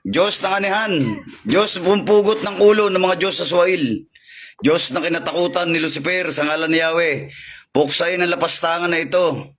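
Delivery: fervent invocation. Acted. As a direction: command the evil spirit like a priest casting out.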